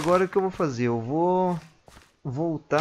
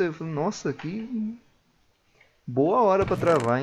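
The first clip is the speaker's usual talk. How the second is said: ordinary talk, nothing marked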